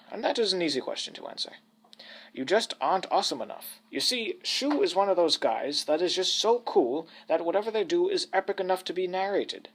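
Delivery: mock-grand British storyteller, sweeping intonation; old British narrator. British narrator explaining himself to the listeners, pompous old man's voice. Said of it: "the patois of an old British man".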